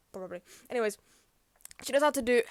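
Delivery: ordinary talk, nothing marked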